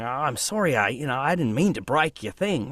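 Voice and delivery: mild Aussie apology. Acted. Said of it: "them talking to a friend going".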